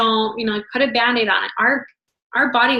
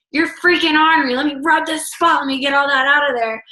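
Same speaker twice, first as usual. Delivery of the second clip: higher, louder scolding mom voice; exasperated mom; 4 semitones higher, 4 dB louder than usual. Pretend. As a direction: scold a teenage son while rubbing his foot. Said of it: "pick up your teenage son's foot and say".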